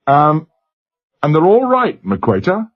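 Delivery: broad Glasgow lad on a crackly line; Glasgow accent. A narrator's reading. Glasgow boy answering the captain over the speaker, Glasgow accent. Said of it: "slurred Glasgow accent".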